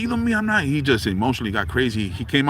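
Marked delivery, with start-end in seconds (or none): 0.0-0.6 high heated protest; mock outrage. Man gets in his face, high mock anger. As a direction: act out a man getting crazy. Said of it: "he got on me".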